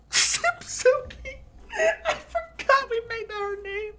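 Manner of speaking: high laughing squeal; cry-laughing over the name. Real, not acted. Laughing fit at silly name.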